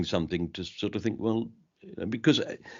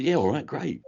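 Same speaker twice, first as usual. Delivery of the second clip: put-on higher London-accented reply; 8 semitones higher than usual. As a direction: answer in London speech. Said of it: "we just talk like that".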